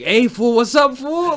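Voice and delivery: put-on high, loud East LA Mexican accent. Showing co-host how people talk, East LA Mexican accent. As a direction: greet loudly, imitating an East LA Mexican accent. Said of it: "with the East L A Mexican accent".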